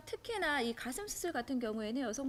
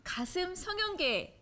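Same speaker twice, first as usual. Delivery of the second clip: grand announcer intro; 6 semitones higher, 3 dB louder than usual. Acted. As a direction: present the guest like a star. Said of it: "이분은 이렇게 얘기하고 싶습니다".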